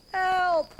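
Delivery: high, loud cry for help; high panicked cry; cried out. Acted. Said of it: "cried the gatekeeper".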